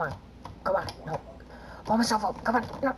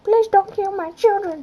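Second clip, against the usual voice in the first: mock pleading parent; 9 semitones higher than usual.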